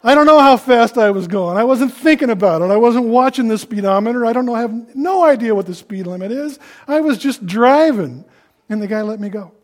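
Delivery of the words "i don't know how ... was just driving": loud, high-pitched helpless confession; hammed-up desperate driver pleading ignorance; throwing oneself on mercy. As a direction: wail your cluelessness to the officer. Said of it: "I just threw myself".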